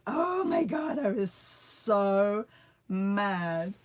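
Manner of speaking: retold outrage, drawn out for emphasis; exasperated anger. Recounting how angry she was.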